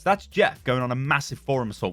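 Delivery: ordinary delivery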